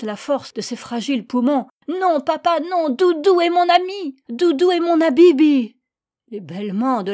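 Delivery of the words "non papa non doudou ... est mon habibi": put-on high, loud screaming plea of a crying child; a boy begging his father to spare the lamb; sobbing scream. A narrator's reading. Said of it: "en sanglotant et en hurlant".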